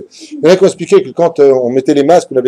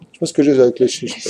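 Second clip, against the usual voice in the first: numb tongue from anesthesia; words slurred by a numb tongue; 5 semitones lower, 7 dB quieter, faster than usual. Real, not acted. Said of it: "C'est l'anesthésie qui s'est bloquée au niveau de la langue".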